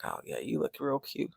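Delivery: low and soft, dripping sarcasm; hushed sarcastic jab; quiet sarcastic drawl. Retold or imitated. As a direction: mutter the compliment like an insult. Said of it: "she's like".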